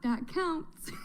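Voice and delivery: words through a laugh. Real, not acted. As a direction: say it while giggling. Laughing heard on line.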